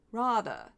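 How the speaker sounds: "rather" with a broad open "ah" and softened final r; affected pronunciation. Retold or imitated. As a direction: imitate an affected "rather", drawn out as "rah-thuh". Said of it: "his affectation of how he says".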